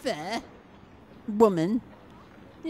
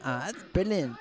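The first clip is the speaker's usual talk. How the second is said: ordinary talk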